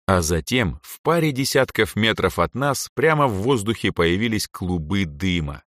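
usual speaking voice

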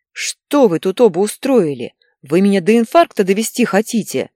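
loud, exasperated father's outburst; yelling. A narrator's reading. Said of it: "заорал Игорь". (отец возмущённо орёт)

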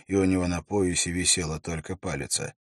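ordinary delivery

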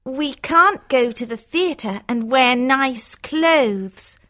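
a hint of a sulky character, drawn out; complaining sister. Hortensia missing city life, complaining.

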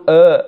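آ as a loose open "uh" in place of i; wrongly opened first sound, آ; mistaken hamza. Shown as a demonstration. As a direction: show the faulty vowel on آ. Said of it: "وإما أن يقول".

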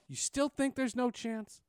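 teasing. Retold or imitated. Teasing the old anchor about his call, slow and mocking. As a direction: rub it in slowly. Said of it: "I tease him all the time".

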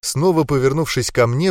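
ordinary delivery, nothing marked

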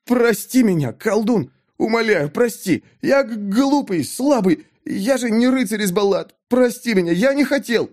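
high, frantic begging for forgiveness; terrified pleading. A narrator's reading. Mik on his knees begging the sorcerer, terrified. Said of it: "Горло сжималось от ужаса, однако он шептал".

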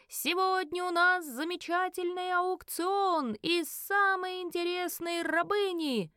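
high, slow, drawn-out showman's announcement; elf auctioneer. A narrator's reading. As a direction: call out the lot like a ringmaster.

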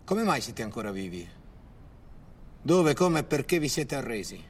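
ordinary talk

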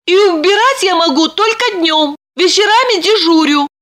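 high-pitched timid job seeker; thin high heroine voice; Lipochka, explaining herself. A narrator's reading. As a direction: play a small high voice pleading her case. Narrator voices the young heroine.

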